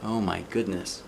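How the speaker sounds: ordinary delivery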